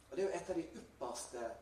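usual speaking voice

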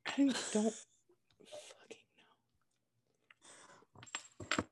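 slow, whispered swearing; hushed, exasperated aside. Real, not acted. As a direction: whisper it to yourself, drawn out. Exasperated aside about noisy device.

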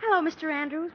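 normal talking voice

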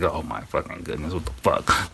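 hushed, disbelieving curse, mostly breath; retold exasperated whisper. Husband learning wife stayed out, whispered disbelief. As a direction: swear in a stunned whisper. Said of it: "And I was just like".

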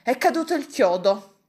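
huffy, offended outburst; resentful tone. A narrator's reading. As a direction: blame everyone, sorely put out. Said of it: "diceva in tono risentito".

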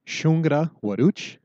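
teacher asking whether the dog ate; asking a question. Shown as a demonstration. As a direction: ask "Shungra waduch?" clearly. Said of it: "So how would you say".

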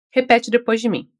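ordinary talk, nothing marked

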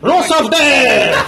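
high, loud, stretched-out chant with a laugh; loud hype shout. Shown as a demonstration. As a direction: belt it out like a wrestling crowd. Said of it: "put more effort in it".

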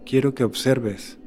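unhurried meditation voice with long pauses; guided meditation. Acted. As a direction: guide the listener calmly into breathing, phrase by phrase.

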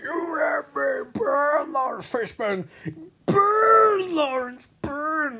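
very high, drawn-out mock wail; hammed-up burning man. Burning teammate accusing Lawrence Fishburne, hammed-up wailing. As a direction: wail it slowly like a vengeful apparition. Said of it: "And the guy's like".

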